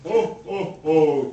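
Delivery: jolly Santa laugh; belly-laugh ho ho ho like Santa; hammed-up Santa chuckle. Santa's laugh opening puppet show.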